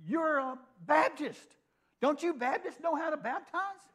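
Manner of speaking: high, slow, put-on disbelieving voice; mock incredulous. Chaplain mocking the nurse's question, high and incredulous. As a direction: stretch it out in exaggerated disbelief.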